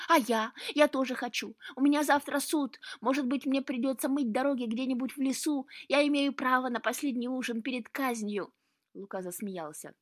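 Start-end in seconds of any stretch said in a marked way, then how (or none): 0.1-8.5 loud, high, urgent protest; pleading excitedly. A narrator's reading. Cat begging Luka to take it fishing, agitated. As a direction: plead your case as if condemned. Cat begs to come along.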